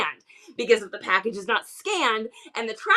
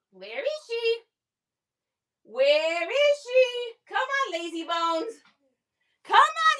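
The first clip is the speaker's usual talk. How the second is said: put-on pet baby talk; 5 semitones higher than usual. To the pet as she calls her over, high and coaxing. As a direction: coax the lazy pet up playfully.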